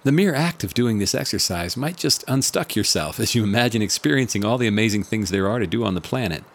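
ordinary talk, nothing marked

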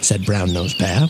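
ordinary delivery, nothing marked

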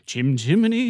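loud, lilting mock Cockney. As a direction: belt out a bad musical Cockney.

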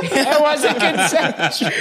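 repeats it slowly through real laughter; cracking up while echoing a friend's punchline; laughing. Real, not acted. Friend echoes the joke while laughing, slow laughing delivery.

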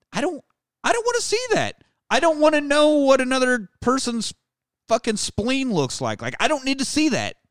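high-pitched, appalled refusal; squirming, high-voiced objection; squeamish protest. Real, not acted.

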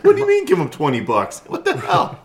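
loud, laughing disbelief; what the hell, laughing and high; incredulous. Real, not acted. Outraged at paying twenty bucks.

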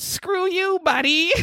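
put-on polite Canadian heckle, Canadian accent. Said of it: "It's very Canadian".

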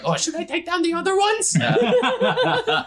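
very high, squeaky eager offer; eager little creature. Acted.

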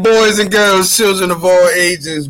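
big showman announcer intro; ringmaster. Acted. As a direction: call it like a circus ringmaster. Podcast intro announcer opening.

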